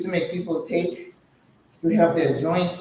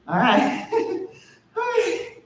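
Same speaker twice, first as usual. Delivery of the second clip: laughing; 13 semitones higher than usual. Real, not acted. Laughing after workout.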